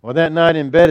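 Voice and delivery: normal talking voice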